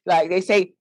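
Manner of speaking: normal talking voice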